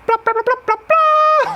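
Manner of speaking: high honking blasts, last one long and loud; imitated cow call. Buddies blowing the cow call, imitated in high blasts. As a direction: blare repeated squawks like a call. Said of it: "like, on the cow call".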